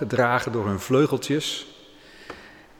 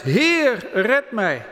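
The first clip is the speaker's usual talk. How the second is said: much higher cry for help, Peter sinking; desperate cry; 9 semitones higher, 3 dB louder, much slower than usual. A narrator's reading. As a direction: cry out to be saved as the water takes you. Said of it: "schreeuwde het uit". (angstige hulpkreet, veel hoger)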